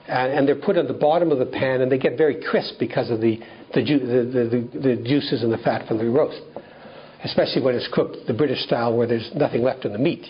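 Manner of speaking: ordinary delivery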